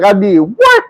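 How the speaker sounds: high, incredulous question; replaying his stunned reaction; acted-out disbelief. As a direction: pitch it up in mock shock. Acts out shock at accusation.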